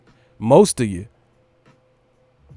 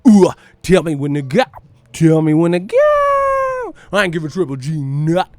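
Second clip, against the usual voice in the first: loud rapper impression, stretched high hook; 4 semitones higher, 6 dB louder than usual. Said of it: "I gotta say it like E four".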